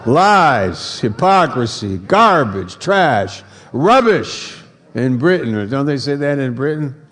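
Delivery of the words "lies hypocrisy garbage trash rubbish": louder and higher, tossing each word out; playful shouted list of words; playful loud call-outs. Shown as a demonstration. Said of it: "have fun with it".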